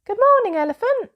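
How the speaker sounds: very high, loud sing-song hello; cheery animal greeting. A narrator's reading. Jungle animals greet Elmer, very high and loud. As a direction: greet him like a chirpy little jungle creature. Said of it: "each one said to him".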